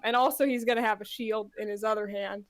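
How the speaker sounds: ordinary delivery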